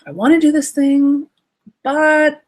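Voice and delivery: put-on high, bright kid voice; eager child. Inner child asking the parent, high eager voice. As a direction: pipe up like a hopeful child. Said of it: "what is that conservative voice saying".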